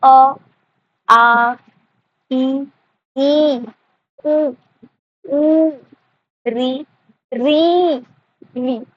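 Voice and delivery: each vowel said clearly and separately, with pauses; vowels recited one by one. Shown as a demonstration. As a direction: say every vowel as its own demo. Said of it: "समनाक्षरी में क्या क्या आता है?". (हर स्वर को अलग-अलग साफ़ बोलना)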